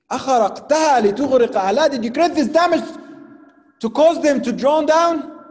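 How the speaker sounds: high, loud, rushed challenge; accusing question. Acted. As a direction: demand an answer in shock. Prophet's accusing question from verse.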